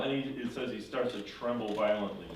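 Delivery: plain reading voice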